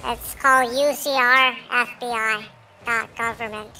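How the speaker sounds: sped-up, squeaky voice changer; chipmunk voice. Real, not acted. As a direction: talk through a chipmunk filter. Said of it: "the middle guy is using the chipmunk voice".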